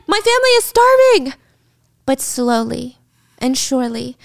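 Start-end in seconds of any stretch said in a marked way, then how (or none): 0.1-1.3 high, loud mock wail; desperate protest. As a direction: cry out in exaggerated desperation. Captive protesting to her captor.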